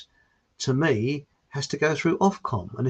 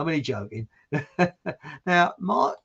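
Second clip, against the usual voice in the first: ordinary talk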